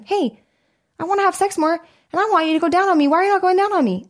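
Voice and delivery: high, fast mock plea to the husband; a wife playfully voicing her complaint to her husband, high and quick; mock demand. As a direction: pitch it up and rattle off the demand. Acted demand to spouse.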